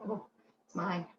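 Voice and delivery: soft, teasing it's mine; hushed playful possessiveness; possessive tease. Shown as a demonstration. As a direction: keep the treasure to yourself, playfully. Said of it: "'Cause you're".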